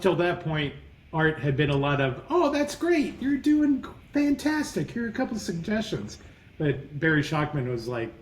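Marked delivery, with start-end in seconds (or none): 2.3-6.2 mock gushing praise. Mocking gushing earlier teachers.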